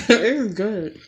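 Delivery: slow laughing delight. Real, not acted. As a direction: laugh as you savor it. Tasting food, laughing.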